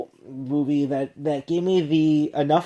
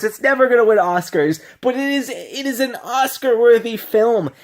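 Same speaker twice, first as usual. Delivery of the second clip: gushing; 6 semitones higher, 3 dB louder than usual. Real, not acted. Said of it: "This is just gonna be me gushing".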